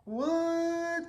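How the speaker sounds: high, squeaked disbelief; a small, high gasp of shock; can't believe what I'm seeing; stunned what. Retold or imitated. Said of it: "And I was like".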